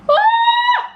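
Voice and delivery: piercing high yell of rage; mock furious shriek. Mother exploding at slow eater, piercing yell.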